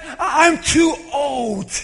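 loud, slow self-pitying cry; self-pitying Naomi. Retold or imitated. Naomi lamenting to Ruth, self-pitying. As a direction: drag it out, sorry for yourself. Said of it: "she says".

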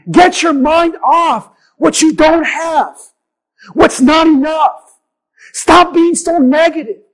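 loud, slow, emphatic preaching; preacher urging the congregation to stop being negative; full force preaching. Real, not acted. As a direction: drive the point home to the congregation.

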